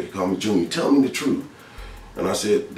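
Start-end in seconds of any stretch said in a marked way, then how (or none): 0.7-1.4 insistent demand, pitched up; retold father's demand. Father pressing his son once more, high retold demand. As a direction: imitate a parent pressing for the truth.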